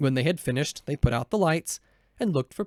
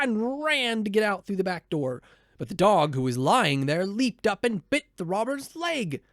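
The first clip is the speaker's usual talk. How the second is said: ordinary delivery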